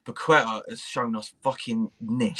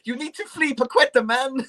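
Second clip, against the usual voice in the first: put-on much higher voice in a failed Brazilian accent that comes out Mexican; bad Brazilian accent; 11 semitones higher than usual. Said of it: "why do you sound Mexican".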